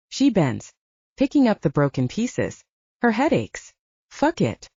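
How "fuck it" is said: ordinary delivery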